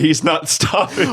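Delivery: high, drawn-out gleeful delight; pure joy. Retold or imitated. Podcaster mimicking a friend's delighted reaction, joyfully. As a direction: stretch it out with mock joy. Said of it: "yours is pure joy".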